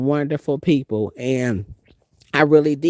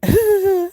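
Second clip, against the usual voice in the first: high, nervous giggle of fear; nervous laugh; 3 dB louder than usual. Retold or imitated. Scared of the bouncer, she laughs nervously. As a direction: laugh it off because you're scared. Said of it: "and I'm laughing, because I laugh when I'm scared".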